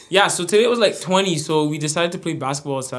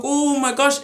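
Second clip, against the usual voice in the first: alarmed; 11 semitones higher, 3 dB louder than usual. Retold or imitated. Mid-air fall retold.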